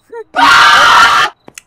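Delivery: loud high unvoiced shriek; real frightened shriek; startled scream. Real, not acted. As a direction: let out a sudden high scream. Sudden scream after relief.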